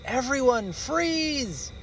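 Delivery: mother bear calling freeze; mom's urgent freeze. Acted. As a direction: stop everyone in their tracks.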